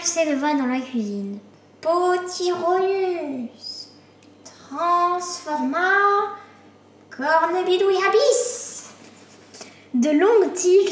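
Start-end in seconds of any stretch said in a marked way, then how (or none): 1.8-8.9 slow, high, drawn-out magic chant; spell incantation. A narrator's reading. Witch casting a pumpkin spell at midnight, chanted incantation. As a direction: stretch each word like an eerie spell.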